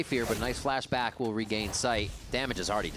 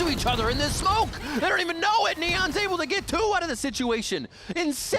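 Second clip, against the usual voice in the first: hyped caster call. Acted. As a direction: scream the highlight.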